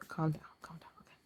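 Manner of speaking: real hushed whisper; whispered self-soothing. Real, not acted. Emotional vlogger calming herself down, whispering. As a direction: whisper it to yourself to settle down.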